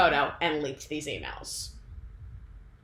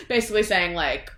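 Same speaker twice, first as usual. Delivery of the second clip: ordinary delivery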